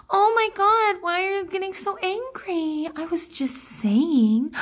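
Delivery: mock passive-aggressive innocence. Imitating the provoker baiting her angry reaction, mock innocent. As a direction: play the smug provoker feigning surprise. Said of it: "which is".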